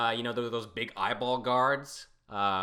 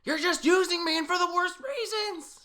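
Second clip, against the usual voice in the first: shrill, higher mock scream; high indignant screech; 7 dB louder than usual. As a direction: squeal it out, offended. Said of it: "screaming at him".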